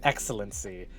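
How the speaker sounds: low, soft satisfied purr; lazy low hum of satisfaction; contented. Retold or imitated. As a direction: savour it like a fine wine, low and soft. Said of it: "I was like".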